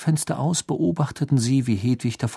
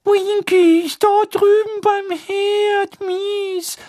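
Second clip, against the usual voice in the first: hoch und gepresst, langsam; Dobby, betrübt und gequetscht; Hauselfenstimme; 4 dB louder, much slower than usual. A narrator's reading. Dobby voiced by narrator. (hohe, betrübte Elfenstimme)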